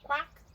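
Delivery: high, loud quack; playful, loud duck quack. Acted. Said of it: "said Duck".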